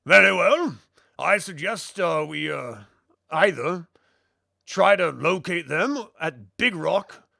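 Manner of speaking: lilting put-on voice, weighing options; deliberating shipmate. Shipmate puts two routes to the crew, deliberate. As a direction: propose the plan in character, with swinging pitch.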